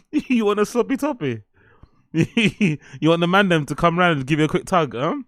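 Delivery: high-pitched, giggly teasing; cracking up while offering; laughing. Real, not acted. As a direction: push the cheeky joke through laughter. Cheeky joke, still cracking up.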